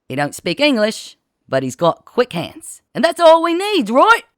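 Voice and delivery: put-on loud, high street urchin from old London; brash London boy showing off his new pal; Henry's cockney urchin voice. A narrator's reading. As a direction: bellow it like a cocky teenage gang leader.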